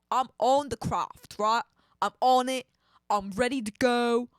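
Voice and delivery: punchy attempted Cockney accent; mock Cockney accent. Astronaut boasting about getting aboard, mock Cockney.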